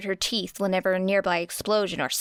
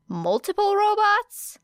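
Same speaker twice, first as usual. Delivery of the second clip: high, slow disbelief; can't believe what she's hearing; high and loud shocked question; incredulous; 13 semitones higher, 4 dB louder than usual. A narrator's reading. Era disbelieving the robot test.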